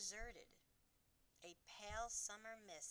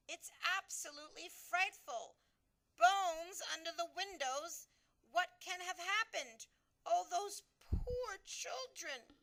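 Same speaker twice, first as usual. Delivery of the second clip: higher, louder, slow horrified lament; horrified Queen; 9 semitones higher, 11 dB louder than usual. A narrator's reading. Queen lamenting the stolen children, horrified. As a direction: grieve for the children.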